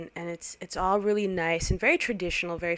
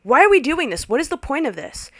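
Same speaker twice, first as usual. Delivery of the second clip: slightly less loud, high repeat; repeated outburst; 7 semitones higher, 8 dB louder than usual. Retold or imitated. Guest repeats it at the father. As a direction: fling it back at him. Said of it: "looked at him and was like".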